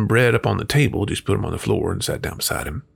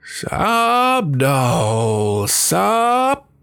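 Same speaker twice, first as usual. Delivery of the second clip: loud, high wail dipping on the middle word; cat's holler; 10 semitones higher, 5 dB louder, much slower than usual. A narrator's reading. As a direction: holler it like an eerie cat's chant. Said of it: "hollered up".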